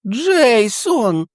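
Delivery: high, loud awed cry; old man crying out. Acted. Old man falling to his knees, high loud cry. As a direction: cry the name out in wonder. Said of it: "закричав старий".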